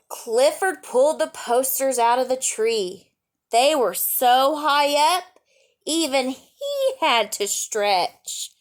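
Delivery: bright read-aloud storytelling voice; lively picture-book reading; story narration. A narrator's reading.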